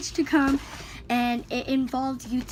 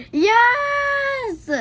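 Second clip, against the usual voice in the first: loud, high cry of triumph; real shout of unboxing glee; excited shout; 13 semitones higher, 7 dB louder than usual. Real, not acted. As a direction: yell it out in pure delight. Delighted by new tripod.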